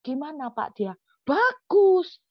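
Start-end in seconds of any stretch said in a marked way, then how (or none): 1.3-2.2 high, loud, delighted "Bagus!"; quoting him gushing about it; an upbeat exclaimed verdict; enthusiastic praise. Retold or imitated. Invitee's verdict on the sermon.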